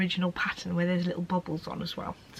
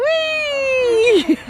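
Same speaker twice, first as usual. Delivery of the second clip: high loud squeal of fun; gleeful whee; 7 dB louder than usual. Real, not acted. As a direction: squeal with delight. Playing with toddler.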